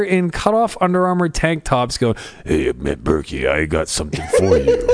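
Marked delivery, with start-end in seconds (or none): 2.4-4.9 exaggerated low macho promo voice; Macho Man Randy Savage impersonation. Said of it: "attempting to do a Macho Man Randy Savage impersonation".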